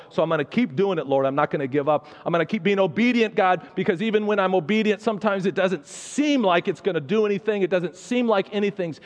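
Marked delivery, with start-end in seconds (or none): 2.2-9.0 fervent prayer. Acted. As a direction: pray with rising passion, voice lifting high. Fervent prayer rising to a cry.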